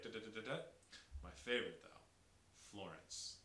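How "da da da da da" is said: staccato run of even syllables; quick, detached syllables showing a rhythm; choppy. Shown as a demonstration. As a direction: rattle off choppy nonsense beats. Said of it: "it's very, almost choppy".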